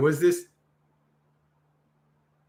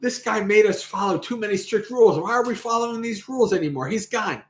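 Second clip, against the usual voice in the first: mock dismissive complaint; 7 semitones higher than usual. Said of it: "people are like".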